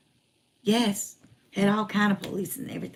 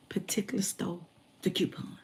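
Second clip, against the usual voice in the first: drops to a whisper on the store; hushed whisper; 3 semitones lower, 10 dB quieter than usual. Real, not acted. Telling the interviewer about the store, in a hushed whisper. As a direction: lean in and whisper it like a secret.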